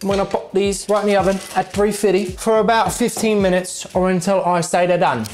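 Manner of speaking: hammed-up British accent; exaggerated fake Brit, tumbling into gibberish. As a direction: go over the top with mock-British slang. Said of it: "use my British accent".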